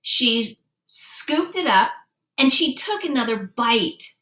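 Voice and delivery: plain reading voice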